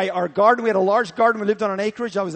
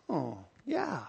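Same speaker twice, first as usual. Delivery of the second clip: grudging mumble; 4 semitones lower, 11 dB quieter than usual. Retold or imitated. Pastor grudgingly answers Holy Spirit.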